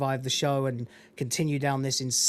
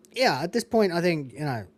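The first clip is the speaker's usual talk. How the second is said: normal talking voice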